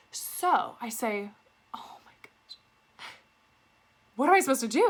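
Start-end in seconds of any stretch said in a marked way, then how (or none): 1.7-2.6 hushed, put-on dread; mock horrified whisper. Pretends panic to fool scammer. 4.2-4.9 loud, high-pitched fake distress; feigned panic. Pretends panic to fool scammer.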